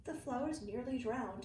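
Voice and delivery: ordinary delivery, nothing marked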